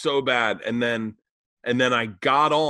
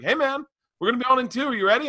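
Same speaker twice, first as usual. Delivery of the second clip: high, fast, hyped radio-jock voice; pumped radio host; 12 semitones higher, much faster than usual. Retold or imitated. Radio host calling the comedian before going live, high and fast. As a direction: play the eager host calling in, quick and high.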